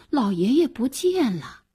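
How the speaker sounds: ordinary delivery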